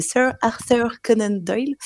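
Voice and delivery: name said with a put-on English accent; a mock English accent on a British author's name. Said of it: "Pardon, mon accent anglais".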